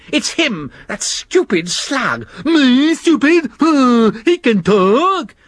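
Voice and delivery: high, petulant bickering; sulky squabbling creatures. Acted. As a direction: sulk and blame the other one. Worm and slug quarrel.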